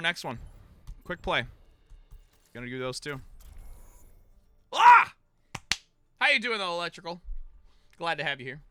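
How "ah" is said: short, high, loud yelp; sudden yelp. Real, not acted. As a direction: burst out with one loud "ah". Sudden cry during stream.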